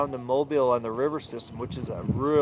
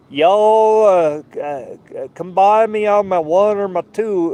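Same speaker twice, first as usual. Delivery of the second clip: loud, high Southern barge-captain voice calling on the radio; Southern hillbilly drawl; 6 semitones higher, 10 dB louder, slower than usual. Retold or imitated. Bargeman answering a sailor over the radio, Southern accent. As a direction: play a friendly Southern river bargeman, Southern accent. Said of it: "all these kinda hillbilly guys down south".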